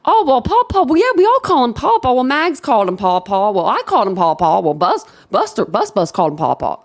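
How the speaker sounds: hammed-up flustered witness; mocking Murdaugh. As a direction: play him scrambling for excuses. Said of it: "never heard you ever say Paw Paw".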